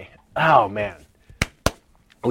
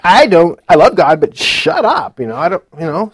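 loud mock wife's "shut up"; wife's retort; 4 semitones higher, 10 dB louder than usual. Wife telling husband to stop preaching at home, loudly. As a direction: blurt it like a fed-up wife. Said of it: "she's like".

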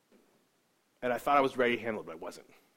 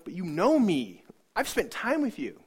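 pleading; 8 semitones higher, 7 dB louder, slower than usual. Retold or imitated. Pleading with God, sobbing.

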